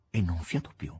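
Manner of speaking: usual speaking voice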